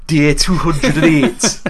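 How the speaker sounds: deadpan Geordie announcer voice; Geordie accent. Retold or imitated. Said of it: "doing the Geordie accent".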